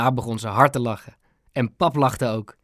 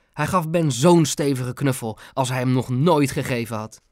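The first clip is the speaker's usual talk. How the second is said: ordinary delivery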